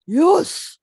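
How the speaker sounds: loud, eager dog voice; eager yes. A narrator's reading. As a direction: George barking out his yes. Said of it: "says George".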